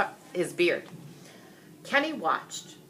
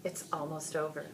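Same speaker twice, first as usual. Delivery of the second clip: soft, hushed murmur to himself; a boy quietly reassuring himself; whispered to himself; 9 dB quieter than usual. Acted. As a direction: keep it under your breath, hoping. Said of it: "he whispered to himself".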